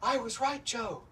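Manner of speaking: loud, high-pitched scream, drawn out at the end; screaming across a restaurant. Retold or imitated. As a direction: imitate an excited fan yelling across the room. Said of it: "She screams out".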